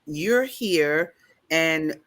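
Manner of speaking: usual speaking voice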